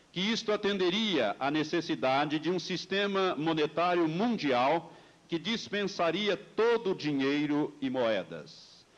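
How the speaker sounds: usual speaking voice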